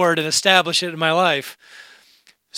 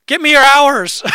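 mock holler with raised pitch; retold yell; 9 semitones higher, 11 dB louder than usual. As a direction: boss calling out from his office, loud and high. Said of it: "yells from his office".

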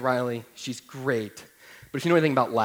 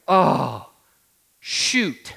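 mild exasperated curse; 3 semitones higher, 4 dB louder than usual. Retold or imitated. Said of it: "Except I didn't say shoot".

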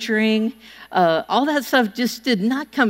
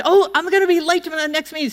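hurried gasp; 5 semitones higher, faster than usual. Retold or imitated. Rushing, looking at watch.

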